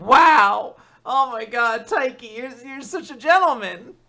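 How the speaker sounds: high, loud, swooning praise; mock swooning gush; gushing fan. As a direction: swoon over him. Gushing over Taiki.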